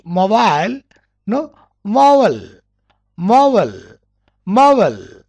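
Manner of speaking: MOBILE as "MO-vul", weak second vowel; American pronunciation. Shown as a demonstration. Teacher drilling American mobile, ending reduced to "vul". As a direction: swallow the end of MOBILE to "vul". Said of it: "mobile in American".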